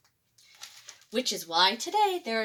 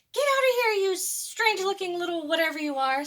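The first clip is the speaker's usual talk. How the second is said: shooing shout; 8 semitones higher, 3 dB louder than usual. A narrator's reading. Dinosaur shoos the chicken.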